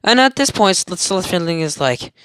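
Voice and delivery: normal talking voice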